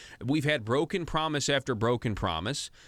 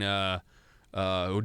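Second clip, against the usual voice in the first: ordinary talk, nothing marked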